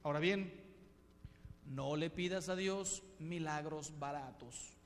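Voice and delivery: usual speaking voice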